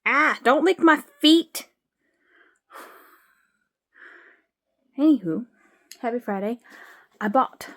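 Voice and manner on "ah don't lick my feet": higher, louder drawn-out protest; real startled objection, pitched up; startled scolding. Real, not acted. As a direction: yelp a protest at a pet. Scolding cat that licks her feet.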